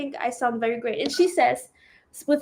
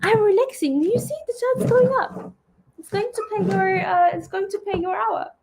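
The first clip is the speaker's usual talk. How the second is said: higher-pitched, playful insistence while being massaged; mock defensive protest; 8 semitones higher than usual. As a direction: protest in a raised playful voice that you are relaxing. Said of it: "And I was like".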